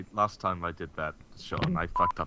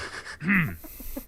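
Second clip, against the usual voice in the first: giggle; 9 semitones higher than usual. Real, not acted. Laughing before his big read.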